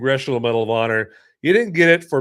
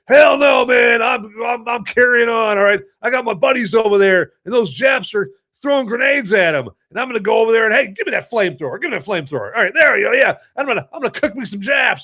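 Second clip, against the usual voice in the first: defiant shout; 7 semitones higher, faster than usual. Pretend. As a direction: roar it out, raring to fight. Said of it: "And you're like".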